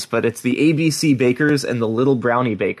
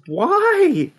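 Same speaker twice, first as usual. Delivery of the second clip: surprised. Real, not acted. Disbelief at the military fact.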